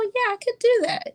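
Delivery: high, mock-agreeable patient voice, pitched well up; mock eager patient. Patients agreeing, acted quote.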